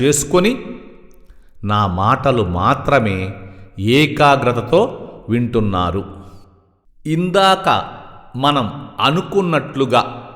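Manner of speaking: soft, hushed meditation voice with long pauses; quiet, unhurried yoga nidra guidance; guided meditation. Real, not acted.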